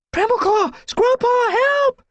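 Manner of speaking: terrified shout calling for help; frightened yowl. A narrator's reading. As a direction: scream for your friends in panic. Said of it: "Leafpaw yowled".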